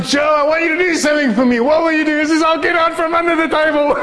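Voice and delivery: high, rushing voice tipping into laughter; amused, excited retelling. Retold or imitated. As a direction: race up high through the joke, then burst out laughing. Excited joking retold exchange, laughing.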